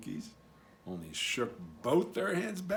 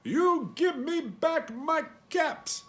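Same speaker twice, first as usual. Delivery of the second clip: high, slow telling-off; scolding peddler; 13 semitones higher, 6 dB louder, slower than usual. Acted. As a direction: demand the caps back, finger wagging. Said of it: "he said, shaking a finger at them".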